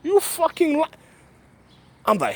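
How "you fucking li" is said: incredulous high cry; shocked disbelief. Retold or imitated. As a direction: act floored by what she said. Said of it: "I'm looking at her like".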